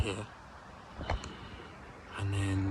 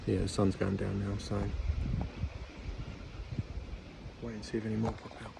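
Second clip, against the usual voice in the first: whispering; 7 dB quieter than usual. Real, not acted. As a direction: keep it barely audible. Whispering in high seat.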